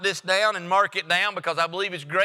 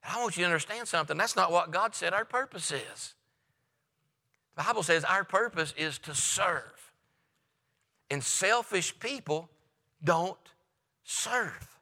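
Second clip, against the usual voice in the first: quiet earnest emphasis; 3 semitones lower, 6 dB quieter than usual. Real, not acted. Preacher drops to quiet point.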